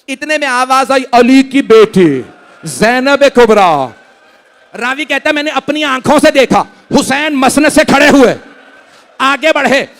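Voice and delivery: high, loud keening recitation; mourning chant pitched high and loud; wailing lament. Acted. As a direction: preach the farewell at full grieving force. Full-force mourning recitation.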